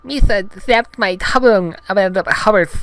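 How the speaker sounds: loud, high alien voice, tongue-tied; Jar Jar Binks. Acted. Injured Gungan explains his accident, loud high lisping voice. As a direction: lisp through a hurt tongue, high and goofy. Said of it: "said Jar Jar".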